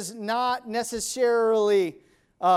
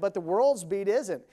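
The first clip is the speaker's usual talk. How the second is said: ordinary delivery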